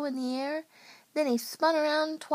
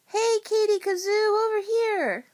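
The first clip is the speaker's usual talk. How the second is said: high, loud hail; calling out; 10 semitones higher, 6 dB louder than usual. A narrator's reading. Somebody calling Katie over, calling out loudly. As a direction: call out across a busy room. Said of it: "somebody called out".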